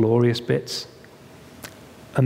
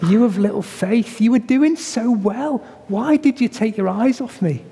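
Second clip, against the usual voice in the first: gentle Jesus; 8 semitones higher than usual. Retold or imitated. Preacher voicing Jesus tenderly to Peter, gentle chiding. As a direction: gently chide a friend who faltered. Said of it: "Jesus' tone of voice was like".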